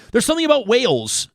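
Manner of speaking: high, loud burst of wonder; awed exclamation. Real, not acted. Host marvelling to his guest, in a high loud burst of wonder.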